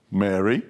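"Mary" short and low, lifting at the end; low questioning "Mary". Shown as a demonstration. Wondering how Jesus said it, short low "Mary". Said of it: "I wonder how he said Mary".

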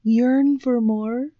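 put-on ad pitch in a dodgy Swedish accent; attempted Swedish accent. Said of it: "This is your best Swedish accent".

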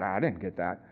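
a second disciple grumbles under his breath; low mumbling disciple. Acted. One disciple mumbles to another, low and hushed. Disciples muttering among themselves.

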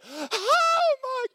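high, loud shocked cry; shocked gasp. Retold or imitated. Woman seeing grease behind the stove, shrieking in shock. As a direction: shriek it like a horrified housewife. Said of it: "and she went".

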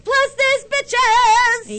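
high, loud shouted hype; catchphrase shout. Acted. Host hyping the audience, loud high shout. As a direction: belt out the show's tagline.

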